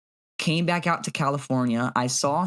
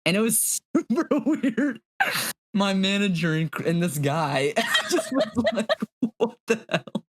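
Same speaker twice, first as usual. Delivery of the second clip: laughing; 6 semitones higher than usual. Real, not acted. Laughing retelling party scene.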